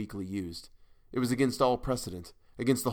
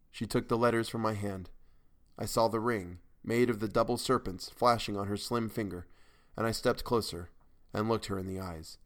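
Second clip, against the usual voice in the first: usual speaking voice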